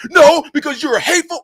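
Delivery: hammed-up indignant comeback; mock offended critic. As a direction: play the upset viewer calling him hateful.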